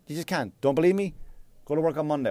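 usual speaking voice

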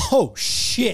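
drawn-out mock "oh shit"; amused shock. Repair guy peers into the skimmer, amused shock. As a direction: imitate a repairman's laughing alarm. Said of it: "the worst part is he's laughing".

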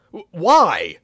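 high, loud, stammered why; irritated shout. Acted. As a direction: burst out in irritation. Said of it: "I shouted, getting irritated".

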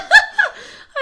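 loud delighted shriek-laugh; loud high laugh. Real, not acted. Reacts to story.

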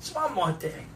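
lightly voiced rumpled TV detective; quiet detective's parting line, offhand; Columbo. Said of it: "Columbo's a fun voice to do".

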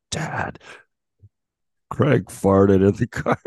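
put-on a kid proudly ratting out a friend; excited kid tattling. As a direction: son telling Dad the news, slow and loud. Kid voice telling on Craig.

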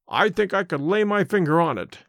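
plain reading voice

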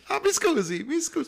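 high-pitched mock-Italian apology; movie train passenger. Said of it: "He's like".

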